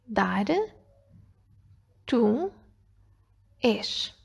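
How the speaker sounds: single syllables with long pauses between; spaced out for filling columns; isolated syllables. Shown as a demonstration. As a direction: dictate them one at a time. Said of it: "a começar pelo fim".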